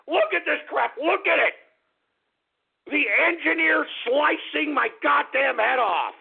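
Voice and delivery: high, indignant holler; outraged yell. Real, not acted. Host showing a graphic of his head sliced off, outraged high yell. Ranting at listeners' graphics of himself.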